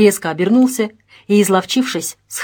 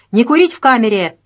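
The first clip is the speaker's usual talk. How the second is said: raised-voice order, higher and louder; sharp reprimand; 4 semitones higher, 6 dB louder than usual. A narrator's reading. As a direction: call out the ban with authority. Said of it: "прикрикнул милиционер". (строгий окрик)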